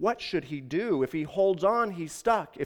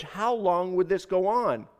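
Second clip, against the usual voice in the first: plain reading voice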